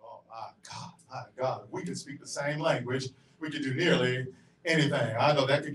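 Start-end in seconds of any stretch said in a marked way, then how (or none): none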